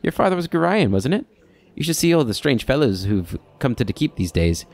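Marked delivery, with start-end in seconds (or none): 0.0-1.3 higher, chirpy townsfolk voice; a hint of an eager gossip; friendly NPC voice. Different NPC greeting.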